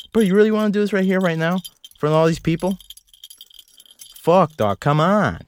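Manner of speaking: a lightly acted angry rant at his dog; mock argument. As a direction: pick a fake fight, voice climbing. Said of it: "That was an argument between me and".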